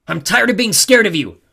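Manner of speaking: high, loud outburst of courage; angry, fed-up defiance; defiant shout. A narrator's reading. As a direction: shout down the one who scared you. Clarice finally defies the god.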